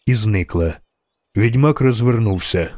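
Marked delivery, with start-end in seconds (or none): none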